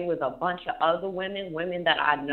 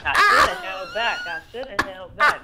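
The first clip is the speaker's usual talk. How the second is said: laughing; 7 dB louder than usual. Real, not acted. Joking through laughter.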